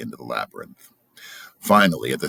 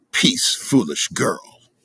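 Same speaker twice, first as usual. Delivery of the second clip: sharp rebuke; 7 semitones higher, 6 dB louder, slower than usual. Acted. Cruel king rebukes daughter.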